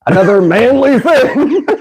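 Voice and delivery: breaking into laughter; high, drawn-out laughing delivery. Real, not acted. Laughing while introducing next food.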